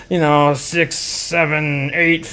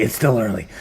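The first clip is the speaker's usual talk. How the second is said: murmured lower repeat; soft lower echo; 6 semitones lower, 6 dB quieter than usual. Shown as a demonstration. Streamer tells crypto fans it's early, then echoes it softly. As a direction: say it again lower and softer, almost to yourself.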